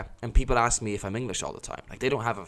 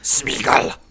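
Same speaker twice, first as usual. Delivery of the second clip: hissy whispered creature voice, louder than usual; Gollum whisper; 3 semitones higher, 9 dB louder, slower than usual. Pretend. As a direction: whisper it like a greedy cave creature. Gollum-style whisper bit.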